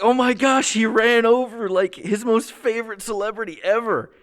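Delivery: high, loud mock shock; shocked exclaiming. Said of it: "And it's like".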